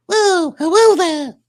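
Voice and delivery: high, loud, drawn-out greeting; playful greeting. Retold or imitated. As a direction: call out a cheeky hello up high. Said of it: "and he says".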